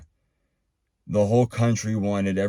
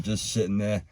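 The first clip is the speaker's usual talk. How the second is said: ordinary delivery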